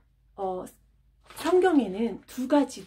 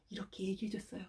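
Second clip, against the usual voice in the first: a quick unvoiced whisper; 13 dB quieter, faster than usual. Real, not acted. Teacher closing the story, whispered. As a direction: drop to a whisper.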